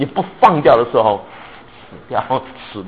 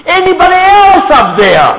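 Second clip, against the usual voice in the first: high, loud call upward, asking for someone else; cheeky shout; 8 dB louder, slower than usual. Retold or imitated. Man on the branch rejects God and calls upward again. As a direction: shout upward, looking for a better answer. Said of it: "然后他说什么".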